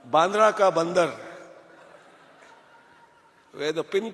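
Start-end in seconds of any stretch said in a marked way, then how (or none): 0.1-1.1 Bandra ka Bandar called out loud; proudly calls himself the monkey of Bandra; loud self-mocking punchline. Speaker jokes to the audience about his hometown, loud and playful.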